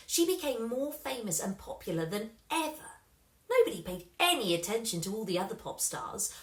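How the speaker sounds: usual speaking voice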